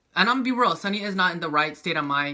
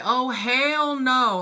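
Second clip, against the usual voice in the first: drawn-out disbelief; 6 semitones higher, much slower than usual. Retold or imitated. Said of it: "I was just like".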